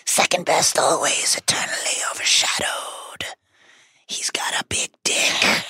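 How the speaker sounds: whispering Horcrux voice. Acted. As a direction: hiss the taunts like an evil spirit. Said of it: "But the Horcrux continues".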